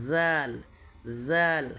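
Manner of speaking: জাল begun as a voiced th; dhal articulation demo. Shown as a demonstration. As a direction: model জাল as a separate, emphatic "dhaal". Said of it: "একটা জায়গায় থেকেই উচ্চারণটা হচ্ছে".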